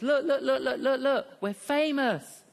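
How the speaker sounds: high, loud, laughing squeal of delight; excited chicken. Acted. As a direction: gush like a thrilled chicken seeing itself on a poster. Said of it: "the chicken turns to the pig and says".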